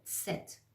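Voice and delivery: ست with a tiny t before the s; soft "tset" for ست, t just audible. Shown as a demonstration. Teacher letting listeners hear it, ست with tiny initial t. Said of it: "ت رو باید خیلی کوچولو".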